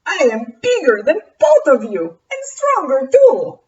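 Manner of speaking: loud, proud vampire boast; boastful vampire. Acted. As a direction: puff up and brag.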